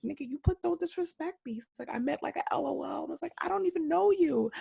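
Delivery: high, exasperated disbelief; incredulous retort. Retold or imitated. Arguing with her date about his text, high and incredulous. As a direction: push it up high in mock disbelief. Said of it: "And I'm like".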